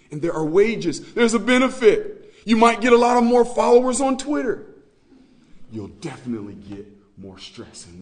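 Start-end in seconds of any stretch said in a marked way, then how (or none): 0.1-4.7 louder, pressing preacher voice; loud emphasis. Real, not acted. Preacher warning about fame, raised and forceful. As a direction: hammer the point about rewards.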